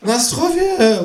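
very high kangaroo toast; drawn-out high cartoon cheer; calling out. A narrator's reading. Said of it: "ruft das Känguru".

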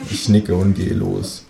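ordinary delivery

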